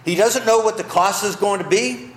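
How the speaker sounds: ordinary delivery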